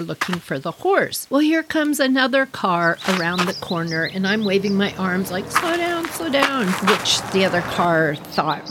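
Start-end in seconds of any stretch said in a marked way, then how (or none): 5.5-6.7 high-pitched mock call to a driver; urgent warning call. Narrator waving down an oncoming car, high urgent call.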